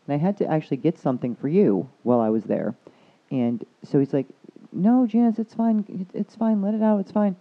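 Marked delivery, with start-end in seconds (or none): 4.7-7.4 high, louder consoling doctor; repeated soothing, pitched well up; imitated insistent reassurance. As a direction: mimic someone urging a crying patient to let go. Doctor urging her to cry, retold.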